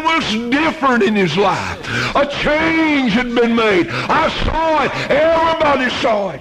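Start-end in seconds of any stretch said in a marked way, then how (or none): 2.2-6.4 preaching fervor. Acted.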